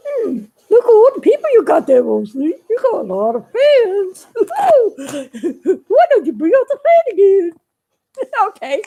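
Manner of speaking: excited puppet teasing his maker. Acted.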